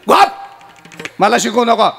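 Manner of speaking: loud telling-off; sharp scolding. Acted. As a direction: shut the child up crossly. Mother scolds the boy.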